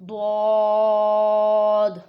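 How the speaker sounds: ضاد held very long and loud; long heavy daad. Shown as a demonstration. Teacher demonstrating ضاد with full length. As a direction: stretch ضاد for four alifs. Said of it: "چار الف کے برابر لمبا".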